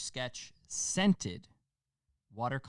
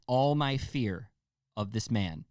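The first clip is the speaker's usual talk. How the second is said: ordinary talk, nothing marked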